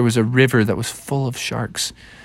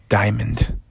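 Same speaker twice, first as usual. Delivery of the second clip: plain reading voice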